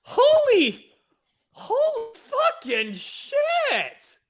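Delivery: high, drawn-out shock; slow, amazed outburst; stunned exclamation. Real, not acted. Stunned reaction to the trailer.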